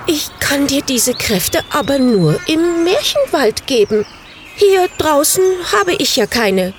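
high innocent-sounding fib; lying little witch. A narrator's reading. Samantha lies to the bird about her powers, high and innocent. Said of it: "log sie".

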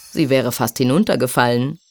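ordinary delivery

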